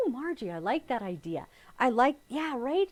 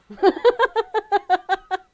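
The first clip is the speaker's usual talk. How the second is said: high, loud burst of laughter; real laughter; 10 semitones higher, 13 dB louder than usual. Real, not acted. Telling viewers her friend's funny story, real laughter.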